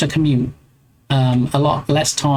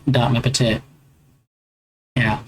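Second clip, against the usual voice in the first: usual speaking voice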